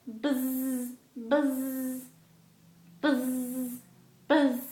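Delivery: imitated bee with held z's; bee buzz. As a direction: buzz like a bee, drawing out the zzz. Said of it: "The bee says".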